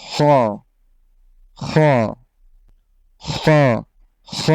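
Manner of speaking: heavy guttural খ; thick Arabic খ; Arabic খ demo. Shown as a demonstration. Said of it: "Bangla খ থেকে একটু মোটা করে পড়লেই".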